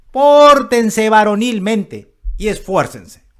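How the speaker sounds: high, loud, slow military order; rally the troops with drawn-out force; commanding general. Acted. General readying a soldier for a military mission, commanding. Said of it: "Pudieran ser estas palabras de un general".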